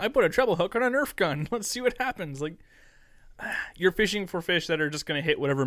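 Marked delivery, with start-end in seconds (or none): 0.0-2.4 high, excited video host; hyped YouTuber voice. Retold or imitated. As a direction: ham it up like a clickbait fisher. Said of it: "they're like".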